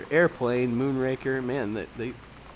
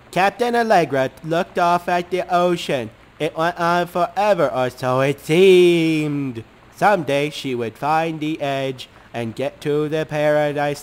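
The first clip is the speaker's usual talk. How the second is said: louder storybook narration; louder, dramatic reading; dramatic story reading; 5 dB louder than usual. Acted. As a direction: read it like a kid's adventure tale. Reads child's story dramatically.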